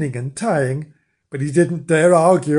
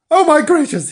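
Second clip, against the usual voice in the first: high, loud startled cry; flustered gasp; 13 semitones higher, 8 dB louder than usual. Acted. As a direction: jump up in alarm. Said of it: "he said".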